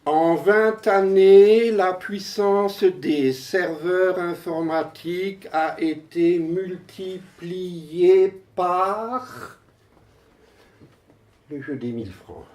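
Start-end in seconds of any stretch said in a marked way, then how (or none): 0.0-9.6 emphatic, drawn-out recitation; poem declamation. Acted. Poet announces the growth of computing power, declaimed. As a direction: declaim it, stretching towards the suspended last word.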